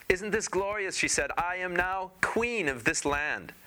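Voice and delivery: very high, slow, preening queen; high gloating queen. Acted. New queen shows husband her land, high and preening. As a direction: savour every word up high.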